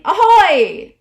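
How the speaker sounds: loud, high pirate shout across the water; playful pirate ahoy; pirate hail. Pretend. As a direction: call out to land like a pirate. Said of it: "like you're a pirate and you're saying".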